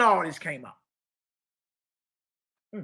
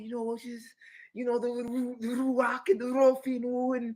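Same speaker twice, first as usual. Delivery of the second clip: mocking imitation with raised pitch, softly mumbled; hushed, higher put-on voice, making fun; Zion Lex and them; 4 semitones higher, 3 dB quieter than usual. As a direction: mimic their affected talk, running words together. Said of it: "I hear Zion Lex and them talking".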